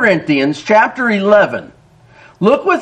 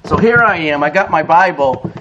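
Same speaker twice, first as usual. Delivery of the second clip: ordinary talk, nothing marked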